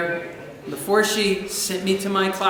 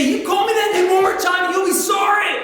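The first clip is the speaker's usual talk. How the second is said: high, hammed-up angry threat; indignant high-pitched warning; mock threat; 10 semitones higher, 3 dB louder than usual. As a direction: threaten like a worked-up kid. Acts out upset child threatening.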